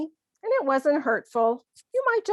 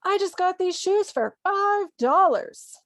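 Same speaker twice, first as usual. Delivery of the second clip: higher-pitched acted excitement over a bargain; very happy; 9 semitones higher, 4 dB louder than usual. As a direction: show off your find with hammed-up delight. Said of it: "Someone's very happy they found some shoes".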